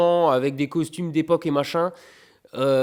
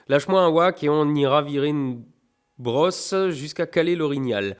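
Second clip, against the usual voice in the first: letter read aloud; 3 semitones higher, 4 dB louder than usual. Acted. Reading letter with Québec slang.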